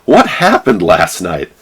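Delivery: clueless friendly call; mock chipper greeting; high cheery hungover guy. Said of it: "And he's like".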